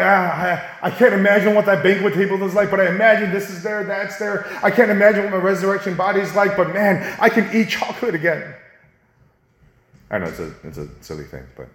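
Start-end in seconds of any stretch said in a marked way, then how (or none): 0.0-8.5 loud, high, delighted imagining of heaven; dreamy excited daydream. Pretend. Believer daydreaming about heaven, loud and delighted. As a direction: wonder aloud at the banquet table. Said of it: "Dream about it".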